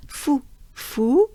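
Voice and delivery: fou said closed and dry with a lift. Shown as a demonstration. Teacher repeats closed fou for learners. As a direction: repeat fou tight and rounded.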